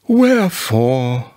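slow, sorrowful pondering; sad musing. A narrator's reading. Eeyore puzzling to himself, sadly. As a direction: draw it out with a touch of sorrow. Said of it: "he thought sadly to himself".

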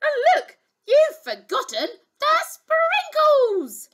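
high, loud, outraged princess; high indignant accusation; exclaimed complaint. Acted. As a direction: scold them like a spoilt child. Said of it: "she exclaimed".

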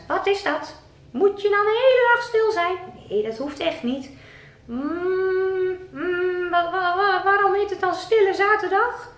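squeaky puppet character. Acted. Puppet Rick greeting the presenter in a high voice.

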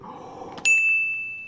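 a sudden high gasp of real shock; sharp gasp. Real, not acted. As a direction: gasp as the gold card appears. Pulls the gold Zacian card.

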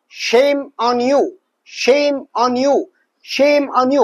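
very slow, every word drawn out; slow drill. Shown as a demonstration. Teacher drilling a phrase for students, very slow. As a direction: spell out each word slowly for the learner. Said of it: "احفظها بالتكرار".

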